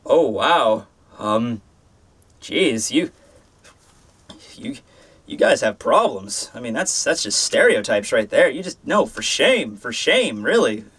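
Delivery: low, halting mock disappointment; feigned shock sinking into low-voiced shaming; mock disapproval. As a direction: scold them in a low, hesitant voice, as a joke. Mock scolding viewers for stereotyping.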